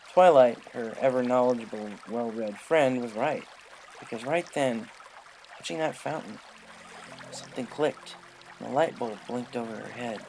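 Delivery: plain reading voice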